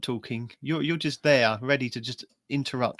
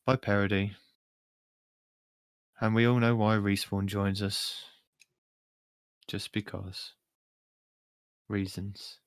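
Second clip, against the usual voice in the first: slow, low intro with weighty pauses; measured deadpan host, dropping low at phrase ends; Alan Rickman; 4 semitones lower, 4 dB quieter, slower than usual. Acted. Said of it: "do my Alan Rickman impression".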